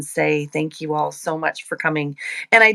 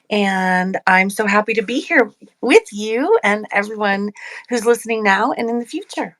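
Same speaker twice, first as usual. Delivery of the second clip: ordinary talk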